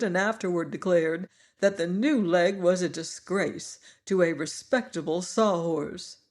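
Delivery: usual speaking voice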